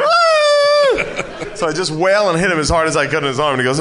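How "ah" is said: very high mock shriek, laughing; imitated scream with laughter. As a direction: scream like him through a laugh. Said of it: "So we go".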